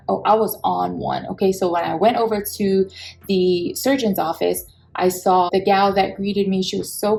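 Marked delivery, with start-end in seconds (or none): none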